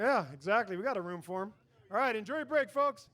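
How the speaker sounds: normal talking voice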